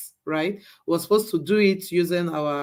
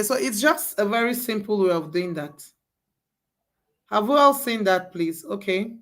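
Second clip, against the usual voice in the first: normal talking voice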